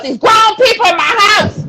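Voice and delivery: loud, high exasperated outburst; exasperated rant. Real, not acted. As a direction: shout the complaint, voice shooting up. Comic rant about broken glasses.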